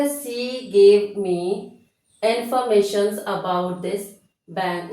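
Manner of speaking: very slow, word by word; slow dictation. Shown as a demonstration. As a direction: read it for students to copy. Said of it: "dictating example slowly".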